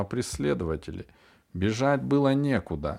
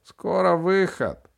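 put-on high, slow old woman's call; 8 semitones higher, 4 dB louder, slower than usual. A narrator's reading. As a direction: draw it out high, lightly voicing an old woman. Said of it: "Это был голос старухи".

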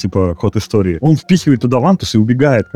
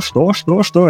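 repeated shocked what; 8 semitones higher, slower than usual. Retold or imitated. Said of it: "И он ещё повторяет".

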